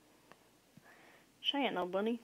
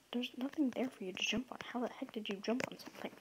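hushed, breathless disbelief; baffled whisper at the screen; 2 semitones higher, 5 dB quieter, faster than usual. Real, not acted. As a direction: whisper it as if to yourself. Baffled at another player's jump.

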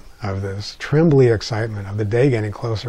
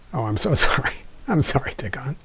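laughing softly; 7 dB quieter, faster than usual. Retold or imitated. Mother laughs and apologizes.

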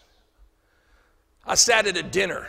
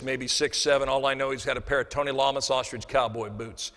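normal talking voice

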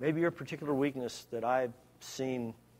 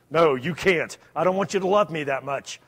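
mock loud refusal; 2 semitones higher, 10 dB louder than usual. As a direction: throw it out loud as a joke answer. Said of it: "What am I gonna say?".

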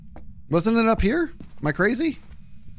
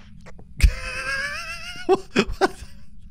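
long, high-pitched laugh; 3 dB quieter than usual. Real, not acted. Streamer cracking up at teammate's feeble effort, high laughter. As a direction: crack up helplessly.

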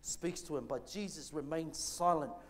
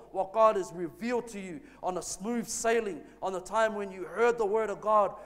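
higher and louder, driving preacher cadence; preaching at full force; 3 semitones higher, 6 dB louder than usual. Acted. Pastor preaching to the congregation about pain, at full force. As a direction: preach it, building to a shout on the storm.

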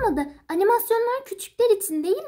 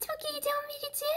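excited breathy whisper; 7 semitones higher, 12 dB quieter than usual. Acted. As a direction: whisper your excitement in the dark. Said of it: "Artık fısıldayarak konuşabilirsin". (heyecanlı fısıltı)

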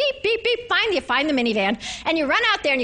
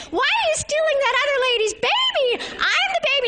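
put-on high little-kid voice; high child's voice, pestering mom about crackers; whiny five-year-old; 11 semitones higher than usual. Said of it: "your two-year-old is saying".

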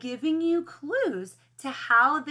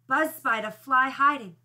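usual speaking voice

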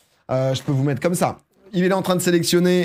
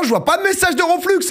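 calling out; 3 dB louder than usual. Real, not acted. Streamer seeing no message from the winner, high calling out. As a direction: pitch leaping very high into a loud cry.

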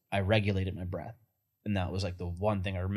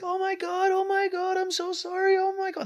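very high, frantic pleading squeal; panicked Balinese mom. Retold or imitated. Imitating the frantic mom who stopped on the highway. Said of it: "she comes up and she's like".

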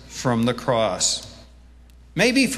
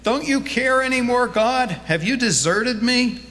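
much higher, whiny complaint to God; mock whining; 8 semitones higher than usual. Preacher imitates us whining at God, mock whine. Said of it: "We do a lot of whining".

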